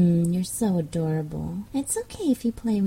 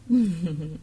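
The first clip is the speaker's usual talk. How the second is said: teasing laugh. Acted. Teasing laugh in hypnosis audio.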